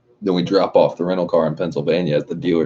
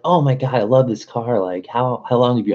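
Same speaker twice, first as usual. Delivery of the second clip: higher, gushing car fan; gushing girl; 7 semitones higher than usual. Retold or imitated. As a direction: squeal over the cute car like a fan. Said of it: "She's like".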